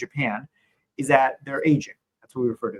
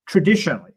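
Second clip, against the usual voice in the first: "traditionally" set apart, higher and louder; emphatic stress; 4 semitones higher, 6 dB louder than usual. Real, not acted. Answering a question on demographics and GDP, stressing "traditionally". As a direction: punch "traditionally" as a caveat. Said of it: "I'm gonna emphasize the word traditionally".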